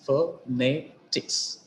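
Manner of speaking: "phonetics" slowly split as pho, ne, tics; syllable by syllable. Shown as a demonstration. Teacher dividing an example word, "phonetics" slowly in separate syllables. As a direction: pause between each syllable of "phonetics". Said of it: "the stress is on penultimate syllable".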